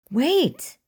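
high, loud cry; desperate call. A narrator's reading. To the attacker choking his friend, desperate call. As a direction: call out to halt him, pitch leaping up. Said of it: "William called out".

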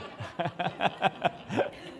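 high, soft chuckling laugh; real laugh. Real, not acted. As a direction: laugh in a run of light bursts. Preacher laughs after own line.

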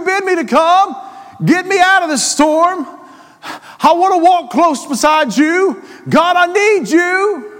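fervent preaching. Acted. Preacher leading the church in a plea to the Lord, fervent. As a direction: cry it out to God.

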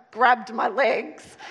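normal talking voice

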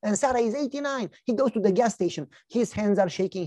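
ordinary talk

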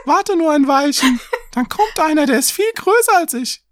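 very high falsetto plea; squeaky, loud little begging voice; pleading little goat. A narrator's reading. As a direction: beg in a tiny kid-goat voice. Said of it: "Der Jüngste aber bat".

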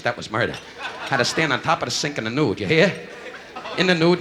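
ordinary talk, nothing marked

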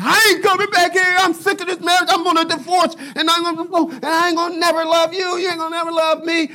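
high, loud acted tirade; hammed-up furious shouting, high-pitched; storming out. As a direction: yell it like a spouse quitting the marriage. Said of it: "they're storming out".

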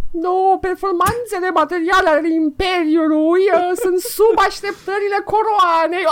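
very high mock-regal falsetto; Marie Antoinette. Mocking corporate jargon as a queen lamenting her empire, high falsetto royal voice. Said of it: "Așa făcea, mă, și Maria Antoaneta".

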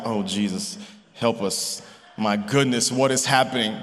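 low, soft retold mutter of exasperation; muttered plea. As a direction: quote yourself pleading under your breath, low. Retold exasperated plea at son's mess.

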